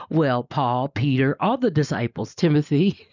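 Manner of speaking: usual speaking voice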